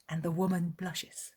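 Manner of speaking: ordinary delivery, nothing marked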